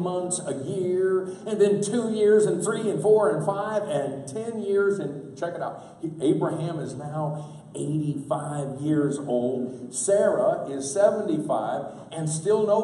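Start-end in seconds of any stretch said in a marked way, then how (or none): none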